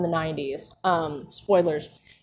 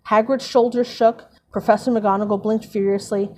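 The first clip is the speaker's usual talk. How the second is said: normal talking voice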